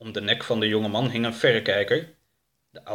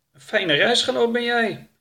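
reproach; 13 semitones higher, 4 dB louder than usual. Acted. Reproachful greeting from behind.

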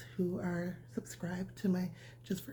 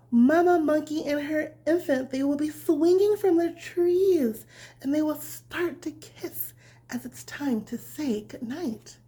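bedtime story reading; 7 semitones higher, 9 dB louder, slower than usual. A narrator's reading. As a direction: read it gently like tucking a child in. Reads bedtime story to children.

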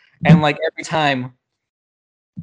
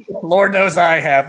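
louder, slightly raised punchline; old-timey radio bit; 4 semitones higher, 5 dB louder than usual. Retold or imitated. Answering the dead-hooker line, old-timey radio punchline. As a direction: deliver it like a radio comedian's topper. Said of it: "this has the rhythms".

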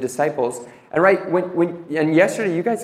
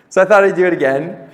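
laughing; 3 semitones higher, 6 dB louder than usual. Real, not acted. Laughs about grabbing picture.

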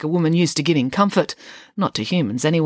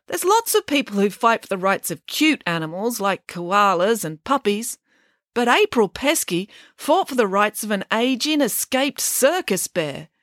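woman in crowd; 6 semitones higher than usual. A narrator's reading.

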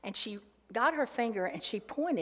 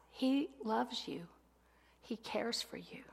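tender reassurance; 2 semitones higher, 7 dB quieter than usual. Real, not acted. Preacher assuring women God loves them, soft whisper. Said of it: "preacher assuring listeners".

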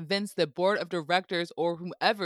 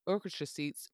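usual speaking voice

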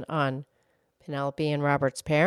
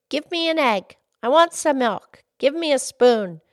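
put-on bossy, louder demanding child; rude demands; 10 semitones higher, 6 dB louder than usual. Boy demanding food at breakfast, rudely. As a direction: bark the orders like a spoiled boy. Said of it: "in the rude way he had".

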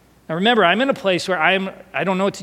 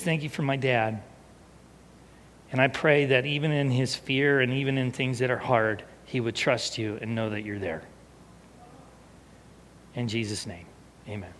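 soft, lower, hushed praying; quiet reverence, fading away; tender retold prayer; 4 semitones lower, 7 dB quieter than usual. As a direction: pray gently and sincerely, sinking quieter to the amen. Retelling small son's prayer, moved.

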